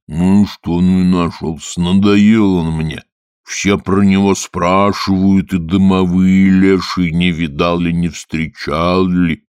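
put-on slow, loud, grumbling old spirit; gruff water spirit. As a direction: grumble it rudely and slowly. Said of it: "Голос у Водяного такой грубый". (грубый голос Водяного)

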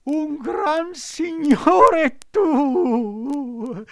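very high, slow, jeering disbelief; mocking fox. A narrator's reading. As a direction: scoff at the puppet's boast. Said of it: "cominciò a ridere di un riso così sguaiato e canzonatore".

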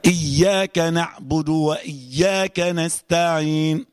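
"إياك" with the doubled ياء held clearly, very slow; careful tajweed reading of "إياك نعبد وإياك نستعين"; correct shadda recitation. Shown as a demonstration. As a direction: stress the shadda in "إياك", very slowly. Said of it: "سامعة؟".

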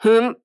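loud, high "hm" of protest; short, sharp-rising offended hum; indignant hmph. A narrator's reading. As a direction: huff it in wordless indignation. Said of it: "Дуг возмущённым взглядом припечатал капитана".

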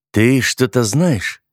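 usual speaking voice

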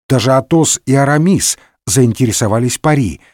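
ordinary delivery, nothing marked

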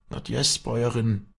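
high, mildly startled exclamation; lightly voiced startled servant; dismayed farmhand. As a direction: react with dismay to your mistress leaving. Farmhand dismayed at mistress leaving.

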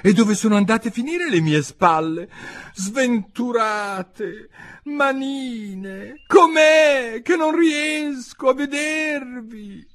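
high, loud wail, drawn out; high-pitched, slow, plaintive cry; distressed Alice. A narrator's reading. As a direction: lament it high, stretching the last words. Alice wailing for her lost shoulders.

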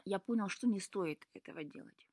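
understated, serious reply. A narrator's reading. Son answering his father after a hard lesson, serious. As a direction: answer soberly, without fuss. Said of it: "ответил Лука серьёзно".

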